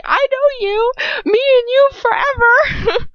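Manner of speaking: very high, loud, drawn-out mock creep voice; mock creeper. Reader mocking Lucius's line, hammed-up creepy. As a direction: ham up an obsessive creeper. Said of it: "Lucy is such a creeper".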